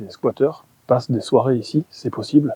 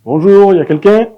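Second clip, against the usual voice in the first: loud, high call into the dark; 9 semitones higher, 19 dB louder than usual. Real, not acted. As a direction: call out to anyone inside. Calling out into empty building.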